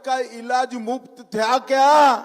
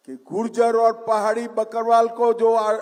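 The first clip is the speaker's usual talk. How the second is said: ordinary talk, nothing marked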